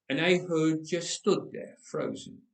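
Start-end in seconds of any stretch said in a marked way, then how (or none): none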